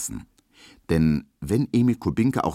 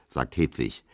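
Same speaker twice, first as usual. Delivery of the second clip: ordinary delivery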